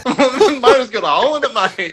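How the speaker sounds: high, loud words through laughter; laughing. Retold or imitated. To friends, retelling the engine hole story, laughing loudly. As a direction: laugh while telling how absurd it was.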